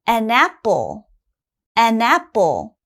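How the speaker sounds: "an apple" run together as one word, the n sliding into apple; linked as one word. Shown as a demonstration. Teacher modelling linking for ESL learners, smooth. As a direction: join "an apple" so it sounds like "anapple". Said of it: "it has to sound almost like one word".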